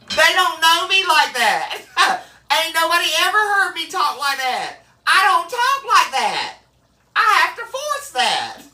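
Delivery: high-pitched, laughing indignation; indignant protest. Acted. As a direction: protest up high, amused and affronted. Protests the forced deep voice.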